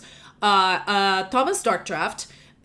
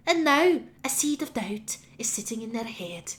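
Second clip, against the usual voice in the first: high witchy voice sinking into a whisper; high hag, fading to a sinister whisper at the end; 4 semitones higher than usual. Acted. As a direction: gloat like a hag sowing chaos. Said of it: "looks up at all of you and says".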